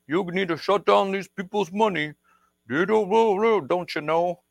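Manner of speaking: high, mock Canadian accent; bad Canadian accent. Officials writing to crypto wallets, mock Canadian accent. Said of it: "how to do a Canadian accent".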